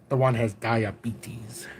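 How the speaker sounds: "diabetes" drawn out slowly, low and soft; joke pronunciation. Retold or imitated. Dad's inside joke for his daughter, "diabetes" slow and low. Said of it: "I used to always say it like that".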